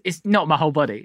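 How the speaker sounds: ordinary delivery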